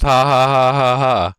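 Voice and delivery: evenly spaced, spoken "ha ha"; mock typed laugh. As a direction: say the laugh as words, without really laughing. Repeats the typed taunting laugh.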